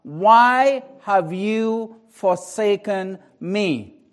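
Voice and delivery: loud, drawn-out cry to God; preacher re-enacting an anguished cry from the cross. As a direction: cry it out slowly and forcefully. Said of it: "he cries out with a loud voice".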